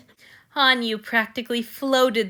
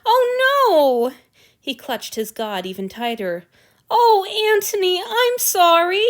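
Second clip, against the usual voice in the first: distressed; 10 semitones higher, 8 dB louder than usual. A narrator's reading. Hanyu realizing his god cries.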